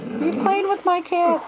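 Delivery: high sing-song coo to the dog. Real, not acted. Owner asks her dog if he's playing with the cat, high baby-talk.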